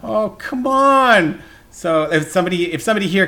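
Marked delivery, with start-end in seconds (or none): 0.0-1.4 exasperated. Real, not acted. Host protesting to co-hosts over hot dogs, exasperated. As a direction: stretch it out in comic indignation.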